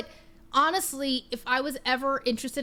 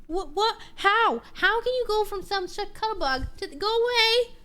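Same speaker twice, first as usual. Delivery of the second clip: high-pitched mock-offended pet voice; pet talk; 6 semitones higher than usual. Real, not acted. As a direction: scold a fickle pet playfully. Teasing her pet.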